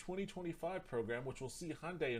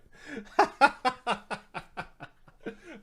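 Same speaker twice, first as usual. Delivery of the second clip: high, loud helpless laugh; cracking up out loud; loud burst of laughter; 12 semitones higher, 13 dB louder than usual. Real, not acted. Vlogger cracks up reading the company plan, loud laugh.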